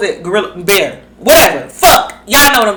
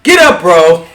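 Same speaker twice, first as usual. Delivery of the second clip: mock call; 3 semitones lower, 5 dB louder than usual. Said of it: "Here go [name] to my son".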